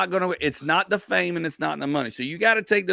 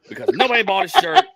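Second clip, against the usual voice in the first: annoyed; 13 semitones higher, 5 dB louder, faster than usual. Real, not acted. Venting annoyance at lost show.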